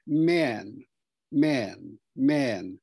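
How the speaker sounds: "man" with a very front vowel, close to "men"; old Queen's man. Shown as a demonstration. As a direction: say "man" the 1950s royal way, almost an "eh". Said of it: "with a very front vowel".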